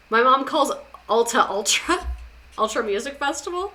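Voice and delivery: normal talking voice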